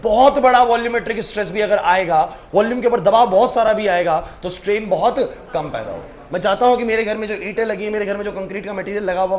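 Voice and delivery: normal talking voice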